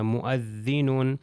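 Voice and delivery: مؤذنون slow, hamza stop, no waw vowel; hamza clearly said. Shown as a demonstration. Teacher modelling مؤذنون for students, slow and careful. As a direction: draw out مؤذنون with a clean glottal stop. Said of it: "واو کو تلفظ نہیں کرنا".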